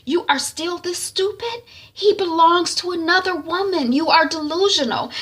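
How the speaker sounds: high, incredulous scolding; exasperated rebuke. Retold or imitated. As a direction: hammer it home to a foolish sister. Sister berates sister.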